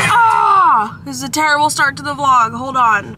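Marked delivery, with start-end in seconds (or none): 0.1-1.0 sudden loud cry of alarm; startled yelp. Real, not acted. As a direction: yelp in surprise.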